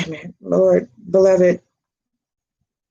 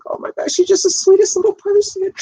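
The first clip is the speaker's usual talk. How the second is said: gushing adoration; 12 semitones higher, faster than usual. Retold or imitated. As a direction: gush over her like a sweet child. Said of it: "I just like".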